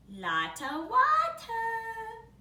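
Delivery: high, loud, slow sing-song shout. Acted. Baby calling about the water, high and slow. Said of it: "shouted Baby Blair".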